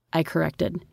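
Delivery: ordinary delivery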